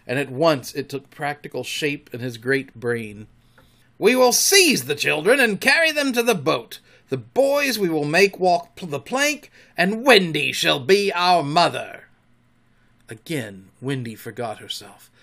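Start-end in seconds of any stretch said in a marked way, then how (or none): 4.0-12.0 loud higher villain plotting; scheming captain. A narrator's reading. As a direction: gloat over the plan. Hook plotting.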